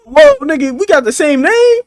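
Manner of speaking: loud, high cry of surprise; shocked outcry. Real, not acted. Shocked they share a name.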